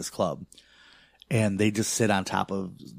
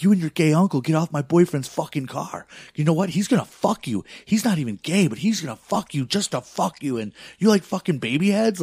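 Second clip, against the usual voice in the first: higher and louder mock tirade; going off; 8 semitones higher, 4 dB louder than usual. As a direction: rant like the loudmouth girlfriend, pitched way up. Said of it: "she's just going off".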